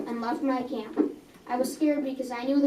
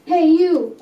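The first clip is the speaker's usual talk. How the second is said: loud, higher shout; guard shouting; 5 semitones higher, 10 dB louder than usual. Acted. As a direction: bark it out like a guard catching intruders. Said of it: "a guard shouted at us".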